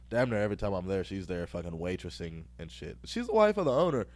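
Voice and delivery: ordinary talk, nothing marked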